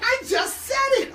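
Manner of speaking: high-pitched, loud playful protest; mock exasperated shout. Real, not acted. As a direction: shout it back in mock indignation. Mock exasperated reply to co-host.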